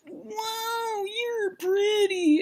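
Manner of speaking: high, slow, drawn-out awe; mock smitten bachelor. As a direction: coo it dreamily up high.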